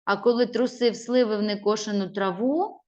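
ordinary talk

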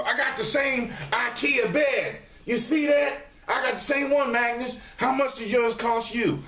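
high, chatty fan voice; eager viewer. Retold or imitated. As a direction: brag like a viewer who spotted it. Mimicking viewer comment.

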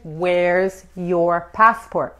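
very slow, drawn-out "Where's your passport?"; slow model phrase. Shown as a demonstration. Teacher modelling the question for Spanish speakers, very slow and deliberate. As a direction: stretch each word of "Where's your passport?" for learners.

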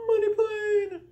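very high, loud squeal of the title; loud falsetto wail; high-pitched cry. Pretend. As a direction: shriek it way up high. Repeated title takes at the end.

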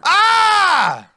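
short high pained yelp. Acted. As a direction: yelp as if just hit. Pained cry in a fight.

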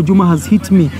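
ordinary talk

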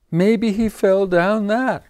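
high, loud wail; wailing cat. Acted. The cat wails fearing the duck fell. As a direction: cry it out over the cliff edge. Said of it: "wailed the cat".

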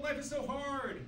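usual speaking voice

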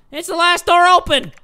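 high, loud desperate protest; acted protest. Husband on the phone to his wife, loud protest.

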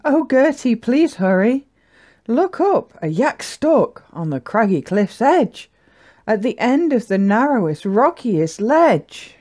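high, pleading alarm; urgent mother yak. Acted. Mummy yak asking Gertie to rescue someone, urgent. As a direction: call out a worried plea for help.

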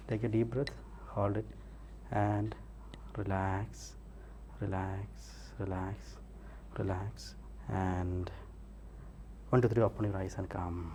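soft, low, spaced-out commands; hypnotic relaxation. Real, not acted. Hypnotist easing the subject before waking, slow hypnotic voice. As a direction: let each "relax" drop quietly, with long pauses.

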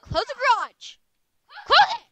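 fed-up mother commanding; angry order. Acted. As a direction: bark the order.

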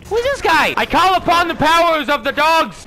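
high, loud proclamation; hammed-up battle invocation; mock summoning cry. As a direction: call down powers with overblown grandeur.

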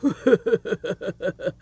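a warm, open-hearted chuckle; hearty laugh. Acted. Rich man welcoming the traveller, hearty laugh. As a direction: laugh welcomingly before inviting him in.

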